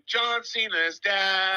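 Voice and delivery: high, long drawn-out chant; imitated crowd yell. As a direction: yell it out like a fan, stretching the words. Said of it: "we all start yelling".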